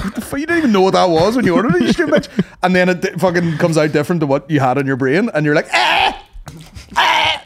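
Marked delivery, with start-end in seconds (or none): 0.0-2.4 mock outraged rant. Ranting at sister in retold thought. 5.7-7.4 put-on very high baby whine. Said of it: "like a baby".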